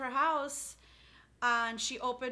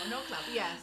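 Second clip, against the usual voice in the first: ordinary talk, nothing marked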